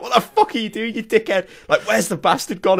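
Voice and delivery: mock outraged swearing, half laughing; retold angry shouting. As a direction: imitate yourself yelling abuse at a teammate. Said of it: "I'm, like, shouting at Kiri".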